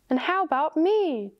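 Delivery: high, indignant little protest; indignant squirrel. A narrator's reading. Squirrel left out of the animals' boasting, high and indignant. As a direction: pitch it up like an affronted squirrel. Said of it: "chittered the squirrel indignantly".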